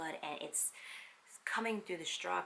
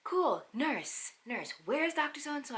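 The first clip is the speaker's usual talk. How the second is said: higher, louder urgent calling; mock agitated doctor; 8 semitones higher, 5 dB louder than usual. Doctor calling a nurse, louder and urgent. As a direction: hurry the nurse along.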